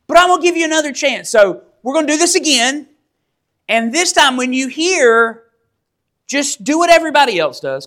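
high, pressed voice of a mildly irked king; angry king. Acted. King telling the three men to bow this time, strained patience. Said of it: "So he's furious".

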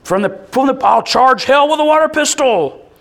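high, loud boast; pumped-up bravado. Retold or imitated. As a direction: brag like a fired-up kid. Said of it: "it was".

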